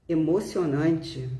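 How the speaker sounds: usual speaking voice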